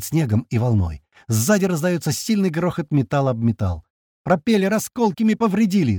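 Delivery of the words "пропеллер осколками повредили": high-pitched urgent cry; alarmed shout. A narrator's reading. Pilot reporting propeller damage, alarmed shout. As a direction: call out damage in a raised pitch. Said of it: "закричал Бат".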